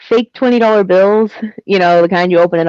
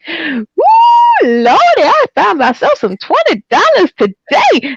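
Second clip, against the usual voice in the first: put-on higher, whooping delighted old lady; lady who finds a fake twenty, overjoyed; imitating an older lady; 10 semitones higher than usual. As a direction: whoop it out like she just struck it rich.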